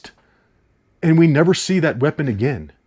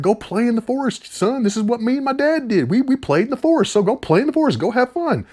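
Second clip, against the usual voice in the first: high, put-on voice of a careless dad shooing his son; mocking the dad; 11 semitones higher than usual. Reviewer mocking the father telling his son to play alone, high and put-on. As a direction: lampoon the father sending his kid off. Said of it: "and he's like".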